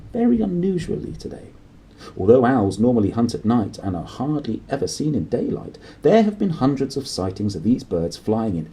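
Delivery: lightly voiced newsreader; news read. Newsreader closes the evening news, light newsreader voice.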